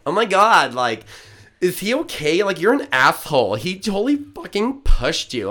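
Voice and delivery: put-on high, loud gushing Valley Girl. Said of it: "Dude, let me do a Valley Girl".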